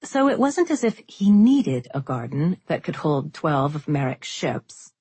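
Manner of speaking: plain reading voice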